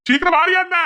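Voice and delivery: very high, loud yell; impatient shouting. Acted. Man yells at his wife to come quickly, impatient shout. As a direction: holler to hurry someone up.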